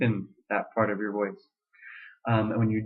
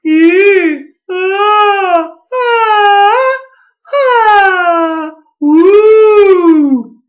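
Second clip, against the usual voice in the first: very high, much louder falsetto; strong falsetto; 16 dB louder, much slower than usual. Shown as a demonstration. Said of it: "I can also go like".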